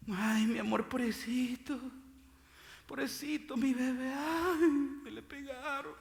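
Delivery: mock coo over a crying baby; coddling parent. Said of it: "si usted mira llorando a su bebé". (imitando a una madre consentidora)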